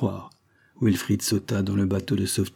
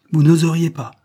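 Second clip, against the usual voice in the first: higher, louder scornful repeat; disdainful; 7 semitones higher, 8 dB louder than usual. A narrator's reading. Wilfrid scorning the sailor who would not dare, disdainful. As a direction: throw it back with open disdain. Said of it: "répéta dédaigneusement Wilfrid Lester".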